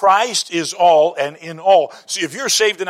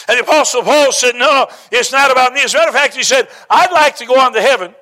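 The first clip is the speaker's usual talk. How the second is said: fervent preaching; 6 semitones higher, 6 dB louder than usual. Acted. Preacher quotes Paul to the congregation, fervent. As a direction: preach it out to the whole room.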